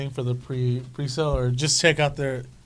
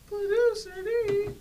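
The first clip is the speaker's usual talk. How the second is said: high drawn-out announcer call; hype intro; slower than usual. Acted. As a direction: call the name out big.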